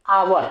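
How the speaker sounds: आवर said with no h, opening on the vowel, firm and loud; hour demo. Shown as a demonstration. Teacher says आवर aloud for the class, silent h. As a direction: model आवर as "our", silent h. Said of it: "इसका pronunciation होता है".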